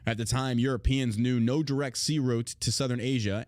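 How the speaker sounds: ordinary talk, nothing marked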